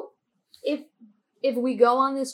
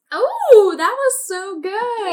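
delighted gushing; 9 semitones higher, 10 dB louder, slower than usual. Real, not acted. Delighted reaction to accent attempt.

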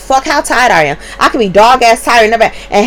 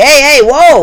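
retold alarmed shout; 4 semitones higher, 5 dB louder than usual. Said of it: "we like".

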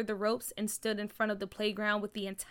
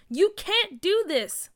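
defiant cry; 7 semitones higher, 5 dB louder, slower than usual. A narrator's reading. Girl defying crew from jungle gym.

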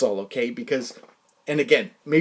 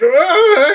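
frustrated outburst; 14 semitones higher, 13 dB louder than usual. Retold or imitated. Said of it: "making myself mental".

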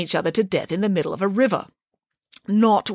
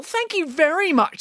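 high-pitched sarcastic thanks; 8 semitones higher than usual. Acted. Frog stung by the scorpion thanks him, sarcastic. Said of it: "being sarcastic with her thank you".

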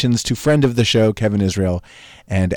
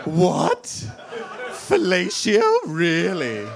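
mock shock; 8 semitones higher, 5 dB quieter, much slower than usual.

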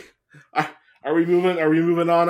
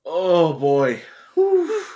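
catching breath after laughing; winded from a laughing fit; after laughing hard. Real, not acted. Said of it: "my sides hurt".

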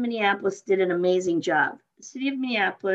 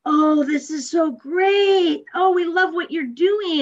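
loud, slow, drawn-out gush, much higher; gushing crowd; 8 semitones higher, 6 dB louder, slower than usual. Retold or imitated. Rally people gushing at her in a loud, slow mock rave. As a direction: overdo the delight, stretched out.

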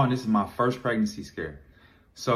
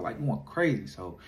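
normal talking voice

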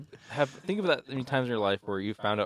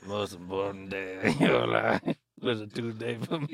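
slow, put-on movie villain voice; Ultron. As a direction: draw it out like a menacing robot villain. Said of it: "Oh, it was Ultron".

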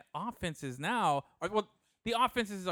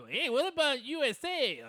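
higher, bright mock call-out; mock interruption; 9 semitones higher than usual. Teasing a friend for switching topic, high mock call. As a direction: pop in cheerfully out of nowhere. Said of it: "you bust out with".

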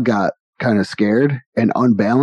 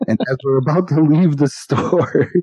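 ordinary delivery

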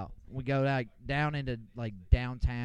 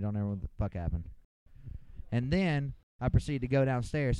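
ordinary talk, nothing marked

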